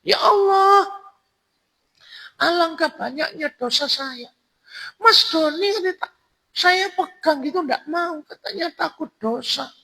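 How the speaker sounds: high falsetto woman lamenting; acted crying in falsetto; mock weeping. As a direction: wail to God in a hammed-up female voice. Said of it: "wanita pelacur itu ceritanya nangis".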